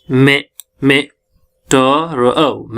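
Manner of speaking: slow, isolated sounds with gaps; syllable by syllable. Shown as a demonstration. Teacher shows students how the word is built, sound by sound. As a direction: sound out "मे", "ट", "र", "अ" one at a time. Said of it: "देखो कैसे".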